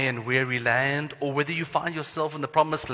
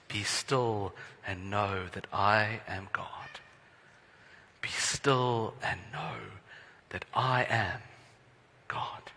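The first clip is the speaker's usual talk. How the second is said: hushed scripture; 4 semitones lower, 7 dB quieter, slower than usual. Acted.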